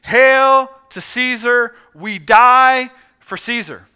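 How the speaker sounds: loud, slow, drawn-out salute; gladiators' salute. Acted. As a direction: proclaim it high and loud to the emperor. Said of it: "he heard the gladiators saying".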